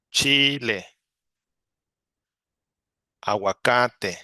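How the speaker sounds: each word said slowly and clearly for students to copy; Tomate, Chocolate, Chile, one word at a time with long pauses; slow model repetition. Shown as a demonstration. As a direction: clear teacher demo of each Spanish word. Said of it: "I will repeat these words for you".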